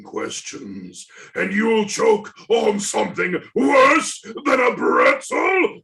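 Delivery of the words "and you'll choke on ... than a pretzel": much higher and louder, a yelled threat; menacing shout. Acted. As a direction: explode into the final threat. Caller's threat erupts.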